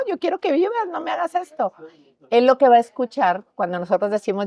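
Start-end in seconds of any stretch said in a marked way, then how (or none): 0.1-1.7 high, pleading cry of a frightened parent; desperate pleading. Retold or imitated. Parent to suicidal child, high desperate pleading. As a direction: beg your child not to do this, voice up high.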